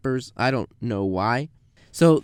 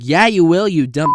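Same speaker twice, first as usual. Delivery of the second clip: louder, higher insult; loud, insulting retort; angry snap; 5 semitones higher, 10 dB louder than usual. Retold or imitated. Customer insulting.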